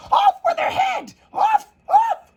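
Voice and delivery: very high screaming Queen; furious Queen screaming at Alice. A narrator's reading. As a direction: shriek the sentence at full tilt. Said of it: "began screaming".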